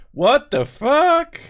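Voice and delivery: high, loud, slow disbelief; shocked. Real, not acted. Host stunned by a dark chat joke, loud. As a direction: blurt it out stunned.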